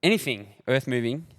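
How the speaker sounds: steady promo read for the sponsor; podcast host reading a sponsor ad. Acted. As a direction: sell the hire company like a radio spot. Reads sponsor ad.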